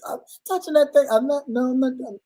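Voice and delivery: refusing the job, pushing it onto the husband; mock wife refusing. Wife telling her husband to fetch the dead mouse, high and protesting.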